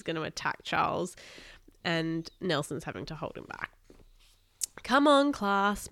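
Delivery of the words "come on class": slow, high teacher's call; drawn-out, raised-pitch summons. A narrator's reading. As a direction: sing out to round up a class. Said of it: "Mister Fletcher called out".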